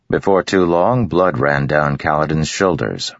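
plain reading voice

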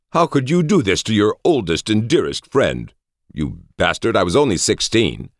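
high, hammed-up indignation; wounded, high-pitched outrage; affronted old friend, protesting. A narrator's reading.

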